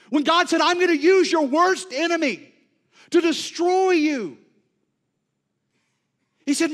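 loud, high, impassioned preaching that slows to drag out each shaken line; preaching at full force. Acted. Preacher voicing the prophet's fear to the congregation, full-force preaching. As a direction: preach it like the storm is already here.

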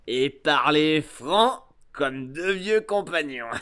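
put-on slow, leering old sea-dog voice; drawn-out pirate voice, swelling loud at the end; wheedling pirate. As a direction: sweet-talk an old shipmate with menace.

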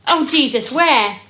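loud, high startled cry. Acted. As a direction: jump at something in the water.

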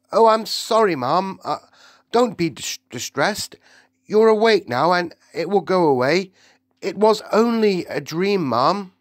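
put-on high, loud, fussing and soothing old maid. A narrator's reading. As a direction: comfort her mistress quickly.

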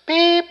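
high squeaky censor beep; self-censor beep. Shown as a demonstration. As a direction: bleep the word out yourself. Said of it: "Sorry, supposed to be P G".

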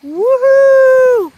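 very high, loud woohoo; excited whoop. Real, not acted. Vlogger whoops at the fair rides. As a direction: let out a real joyful whoop. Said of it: "I'm just so excited now".

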